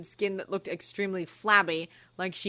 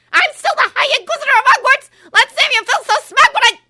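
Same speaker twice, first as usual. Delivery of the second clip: furious shouting; 20 dB louder than usual. Acted. Umbridge raging threat.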